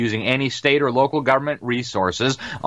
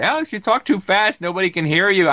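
high-pitched mocking listener voice; mock complainer; 9 semitones higher than usual. Listeners sending the host feedback, high mock complaint. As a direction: imitate a whiny complaint in a raised pitch. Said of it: "I always get feedback that".

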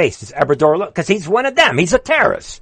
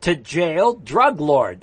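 ordinary talk, nothing marked

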